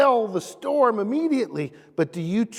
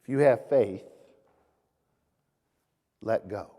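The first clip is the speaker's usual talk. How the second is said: calm voice of God; 8 semitones lower than usual. Retold or imitated. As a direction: answer gently, fading on let go. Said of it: "And the voice says to him".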